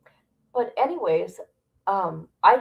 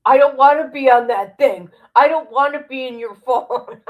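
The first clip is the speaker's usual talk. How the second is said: higher, louder mocking dad voice; imitating angry dad; 4 semitones higher, 7 dB louder than usual. Daughter mocks her dad yelling about being filmed. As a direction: mimic dad ranting about the phone. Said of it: "he lost his mind".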